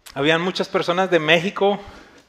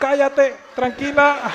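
high-pitched, retold shushing; urgent hushing; 10 semitones higher, slower than usual. Pastor tells the shouting woman to calm down, urgently hushing.

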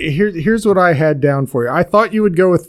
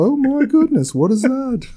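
higher-pitched mock-Liverpool surprise; 9 semitones higher than usual. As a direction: exclaim in a put-on Liverpool accent. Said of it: "talk like [name]".

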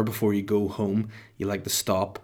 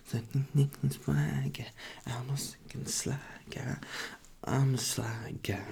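slow, hushed whisper; whispered intro; 9 dB louder, slower than usual. Pretend. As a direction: whisper it like a silly theme tune. Whispered podcast intro jingle.

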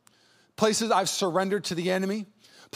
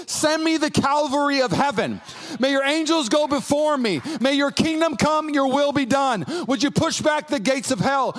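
louder, higher, urgent war cry of prayer; urgent wartime prayer; 7 semitones higher, 5 dB louder than usual. Retold or imitated. Pastor showing a wartime prayer to the church, loud and urgent. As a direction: call for backup from heaven. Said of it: "a wartime walkie-talkie saying".

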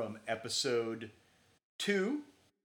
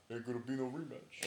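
Rocky impression; 6 dB quieter than usual. Podcast host quotes the famous last line, in Rocky's voice. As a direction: mumble it softly like the boxer from the film. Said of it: "Apollo Creed's line in Rocky's voice".

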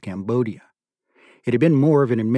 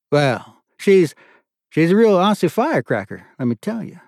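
raised, louder, pleased colonel; delighted colonel; 8 semitones higher, 6 dB louder than usual. A narrator's reading. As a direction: warm up as he recognises the name. Colonel remarks on the mother.

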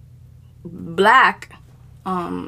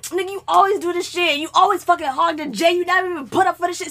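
higher, quickening accusation; mock angry rant; 5 semitones higher than usual. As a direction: imitate a friend getting madder as he complains. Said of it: "is getting even madder".

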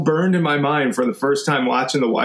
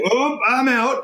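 panicked droid; 9 semitones higher, slower than usual. Pretend.

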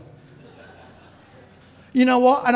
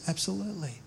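soft affirmation; 13 dB quieter than usual. Acted. Dropped answer.

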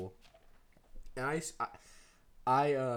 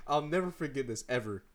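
normal talking voice

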